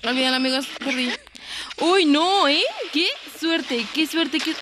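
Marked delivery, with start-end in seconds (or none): none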